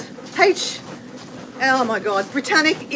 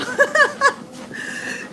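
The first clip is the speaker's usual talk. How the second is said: high burst of giggles; real high laugh; 13 semitones higher than usual. Real, not acted. Laughs at own remark.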